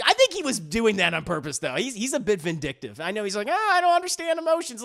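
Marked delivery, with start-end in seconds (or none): 3.5-4.8 much higher, faster mock-innocent creature; mock innocence. Teller mocking the creature's fake innocence, high-pitched. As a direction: play dumb in a squeaky voice.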